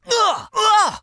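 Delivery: effort grunt. Acted. Battle exertion grunts.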